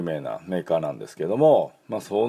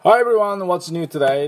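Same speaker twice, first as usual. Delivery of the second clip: bright greeting; 10 semitones higher, 6 dB louder, slower than usual. Real, not acted. Cheerful show opening.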